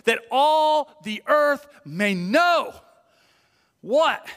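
slow, loud scripture declaration; forceful preaching. Acted. David's declaration preached to all this assembly, forceful and slow. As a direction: proclaim it to the whole assembly, drawing out key words.

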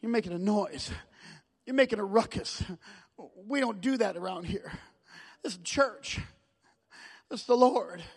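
low, hushed scolding; stilling him, acted out. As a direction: play religious people hushing a disruption. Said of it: "They stilled him".

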